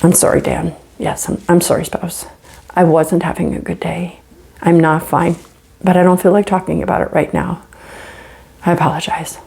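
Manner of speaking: softened, subdued, sincere admission; calm apology. Acted. Apologizing to spouse after a bad day, calm and subdued. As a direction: own up gently, without heat.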